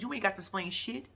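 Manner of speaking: ordinary talk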